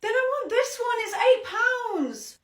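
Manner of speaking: put-on high, loud whining girl; demanding child. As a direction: demand it like a spoilt kid. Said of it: "ten-year-old".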